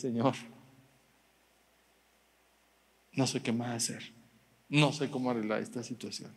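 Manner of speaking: soft, low retold plea to God; quiet sincere prayer. Preacher retelling his tearful prayer, softly pleading. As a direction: pray it softly, near tears. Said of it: "sinceramente le dije".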